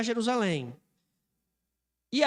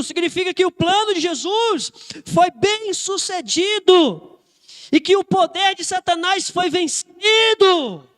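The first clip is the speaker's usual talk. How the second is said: louder, higher sermon delivery with stretched key words; impassioned preaching; 6 semitones higher, 6 dB louder, slower than usual. Real, not acted. Preacher proclaiming victory over death to the congregation, impassioned preaching. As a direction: build to a ringing, triumphant proclamation.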